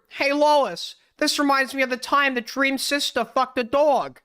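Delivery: a light Family Guy cutaway voice; Peter Griffin cutaway. Retold or imitated. Doing a Family Guy cutaway, lightly voiced Peter Griffin. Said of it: "Family Guy cutaway".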